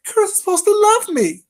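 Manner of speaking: high loud mimicking squeal; mock her voice, high and loud; sneering high imitation of her. Mocking her claim, shrill.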